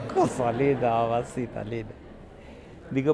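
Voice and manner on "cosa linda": usual speaking voice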